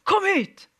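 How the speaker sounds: high, slow bark of a coach; coach calling. Retold or imitated. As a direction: call him over like a strict coach. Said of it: "han bare".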